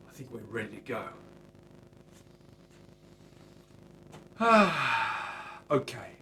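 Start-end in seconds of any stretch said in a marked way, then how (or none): 1.1-5.6 long drawn-out tired sigh; long loud sigh. Real, not acted. Finishing the guitar's coats, long weary sigh. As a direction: let out a big weary sigh.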